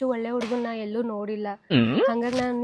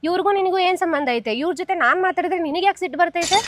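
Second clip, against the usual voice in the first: higher, louder heated comeback; defending herself, raised voice; angry retort; 6 semitones higher, 5 dB louder than usual. Acted. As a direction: snap back at the jealous friend. Friends quarrel over a boy.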